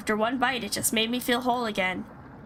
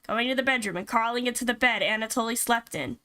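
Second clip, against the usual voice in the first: ordinary delivery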